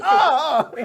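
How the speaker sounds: very high, loud "oh"; thrilled cry. Acted. Guard disarmed by his idol, thrilled squeal. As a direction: squeal it out, overwhelmed.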